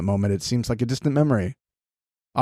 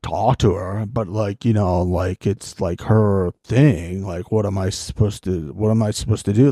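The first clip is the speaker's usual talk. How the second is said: playing Josh. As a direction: stammer and dodge like a guilty Josh. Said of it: "I'll be Josh".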